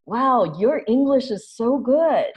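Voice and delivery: imitating a stranger's sugary, patronizing praise. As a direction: act out a lawyer with little doe eyes, gushing. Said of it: "it's like".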